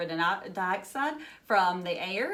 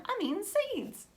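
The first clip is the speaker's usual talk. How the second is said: put-on a child's high, wide-eyed wonder; a hint of a thrilled child, high and breathless; amazed kid; 13 semitones higher than usual. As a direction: play a kid discovering it. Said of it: "Or if you're eating corn".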